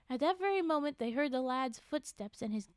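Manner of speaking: steady read-aloud storytelling voice; measured storybook reading; story narration. A narrator's reading. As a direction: read the tale to a listener.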